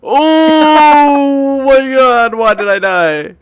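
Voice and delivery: long drawn-out laughing wail; shocked wail. Real, not acted. Character dies in game.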